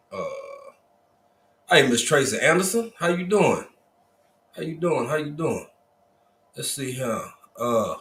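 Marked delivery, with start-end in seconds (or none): 1.7-5.7 excited greeting. Real, not acted. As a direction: sing out the hello to a regular. Livestream host greeting viewer.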